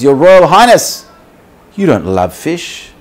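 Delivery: high, loud appeal to royalty; pleading address. Acted. Condemned fish turning to the king, loud appeal. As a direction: call out to the king, voice lifted.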